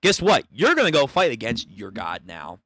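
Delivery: ordinary talk, nothing marked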